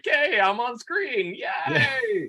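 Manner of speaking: slow, high, hammed-up cheer ending in a squeal; mock excited coworker. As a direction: play a thrilled coworker popping onto the screen. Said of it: "and then they'll be like".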